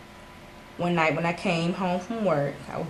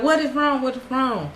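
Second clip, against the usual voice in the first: high, insistent repeated question; retold frantic demand for an answer; urgent demand; 6 semitones higher than usual. Retold demanding answer from crying friend.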